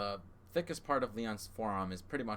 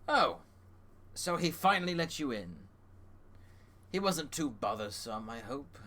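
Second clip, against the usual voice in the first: raised, lively rabbit host greeting guests; Humphrey voice; 5 semitones higher, 4 dB louder than usual. Acted. Rabbit Humphrey greeting the elves, pitched up and lively. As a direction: perk up and welcome them brightly. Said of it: "He says".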